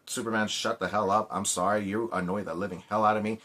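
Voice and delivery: normal talking voice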